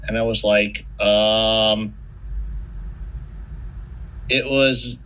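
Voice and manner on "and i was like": ordinary delivery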